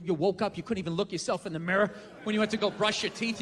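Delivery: usual speaking voice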